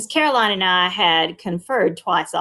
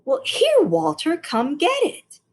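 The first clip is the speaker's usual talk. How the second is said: impatient; 9 semitones higher than usual. A narrator's reading. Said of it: "Impatience crept into Miss Caroline's voice".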